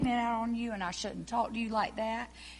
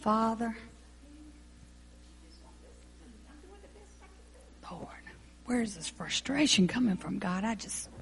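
earnest prayer; 4 dB louder than usual. Acted. Praying aloud to God.

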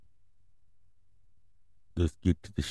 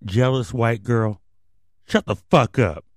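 angry; 3 semitones higher, 5 dB louder than usual. Real, not acted. Yelling back at mocking voice.

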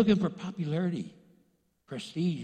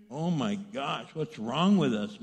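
ordinary talk, nothing marked

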